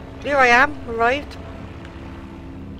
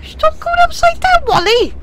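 much higher, loud frantic plea; 13 semitones higher, 3 dB louder than usual. Real, not acted. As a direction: beg the plane to behave. Plane flipping over.

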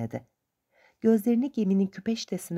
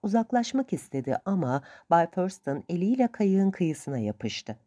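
ordinary delivery

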